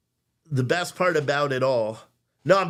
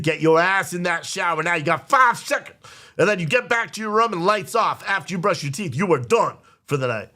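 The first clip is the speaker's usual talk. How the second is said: loud, higher angry orders; stern yelling; 5 semitones higher, 4 dB louder than usual. Retold or imitated. Dad ordering his son into the shower, loud and angry. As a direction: bark it like a furious dad laying down the law.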